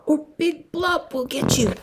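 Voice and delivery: high, put-on little voice warning; tadpole warning. A narrator's reading. Tadpoles teasing Tad about the big fish, high voice.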